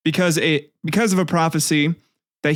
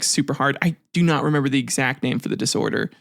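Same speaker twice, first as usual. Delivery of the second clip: normal talking voice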